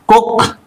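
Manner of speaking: Cook said quickly with a brief "oo"; short vowel. Shown as a demonstration. Teacher giving the example word, short vowel in Cook. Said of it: "Short vowel sound".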